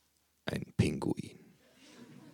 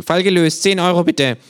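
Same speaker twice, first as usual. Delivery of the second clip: much higher, louder case-closed line; same smug detective formula; smug kangaroo; 12 semitones higher, 8 dB louder than usual. Acted. Känguru voiced by narrator.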